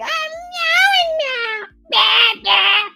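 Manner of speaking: laughing meows. Pretend. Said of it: "that's what I feel like".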